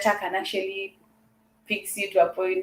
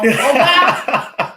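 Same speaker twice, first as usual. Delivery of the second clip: Nigerian accent; 7 semitones higher, 7 dB louder than usual. Shown as a demonstration. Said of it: "Can you just do a little bit of a Nigerian accent".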